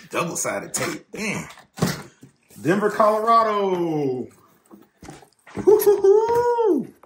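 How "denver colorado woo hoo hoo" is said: high slow whooping cheer; excited whoop. Real, not acted. Cheering at the parts.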